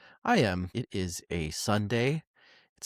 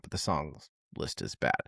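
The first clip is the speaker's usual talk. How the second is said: low, quiet verdict; 8 semitones lower, 7 dB quieter than usual. Real, not acted. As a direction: drop low and soft on the repeat. Repeating verdict for emphasis.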